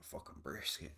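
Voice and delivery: breathy whispered aside. Real, not acted. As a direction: mutter it under your breath. Muttered aside after a joke.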